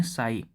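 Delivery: "साई" said as sigh, silent p; pronunciation demo. Shown as a demonstration. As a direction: say "साई" like the English word sigh. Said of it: "pronunciation होता है इसका".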